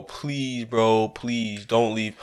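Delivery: soft, slow plea to the opponent; quiet begging. Real, not acted. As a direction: beg quietly, drawn out. Begging opponent not to leave.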